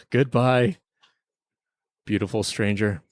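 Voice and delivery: usual speaking voice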